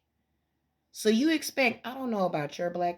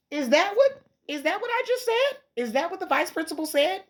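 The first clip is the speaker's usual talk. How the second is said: incredulous; 8 semitones higher than usual. Real, not acted. Challenging the vice principal.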